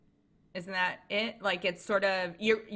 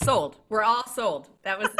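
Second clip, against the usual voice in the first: louder, bright playful praise; excited joking; 2 semitones higher, 8 dB louder than usual. Real, not acted. Host loudly jokes that the guest's pitch sold everyone, excited. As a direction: sell it like the deal just closed.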